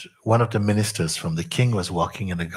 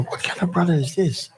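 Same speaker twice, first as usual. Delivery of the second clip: shocked; 11 semitones higher than usual. Retold or imitated. Minister shocked by queen's embrace.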